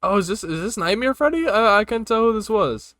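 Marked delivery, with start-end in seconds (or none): none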